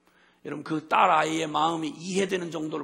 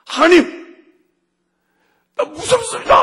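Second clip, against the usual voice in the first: much higher, much louder desperate shout; crying out in fear; 14 semitones higher, 18 dB louder than usual. Retold or imitated. Preacher reliving crying out to God in fear, desperate shout. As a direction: cry out to God in terror, full voice. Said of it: "소리 지르고 우는 저를 발견한".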